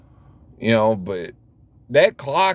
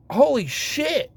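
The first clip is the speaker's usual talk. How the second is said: stunned; 11 semitones higher, slower than usual. Retold or imitated. Retold shock, high slow.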